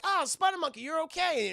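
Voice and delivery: high, loud delighted cry; mock relieved surprise. Heroes finding Spider Monkey alive, loud relieved surprise. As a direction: greet a survivor with hammed-up relief. Acted greeting of survivor.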